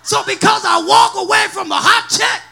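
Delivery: rousing preacher calling the congregation to respond; full-force preaching. Acted. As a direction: preach hard, punching and stretching key words.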